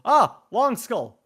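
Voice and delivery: loud surprised yelp; startled shout. Real, not acted. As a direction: jump at the sight. Startled by skull.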